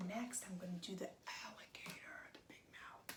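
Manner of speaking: hushed little reveal; whisper. Acted. Finding the shark's head, whispered. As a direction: let it out under your breath. Hushed narration while crafting.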